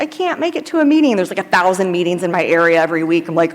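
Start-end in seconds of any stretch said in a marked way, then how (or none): 0.0-1.2 much higher, mocking voice for someone making excuses. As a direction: pitch it up high to poke fun at the excuse. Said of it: "someone's like".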